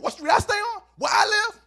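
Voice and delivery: high, loud, indignant outburst; defensive shouting. Acted. Gang member answering where he lives, defensive shout.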